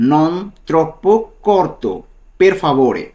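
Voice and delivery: very slow "non troppo corto", every syllable drawn out; slow model phrase. Shown as a demonstration. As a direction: stretch it for the students to copy. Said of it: "পুরোটা যদি একসাথে বলি".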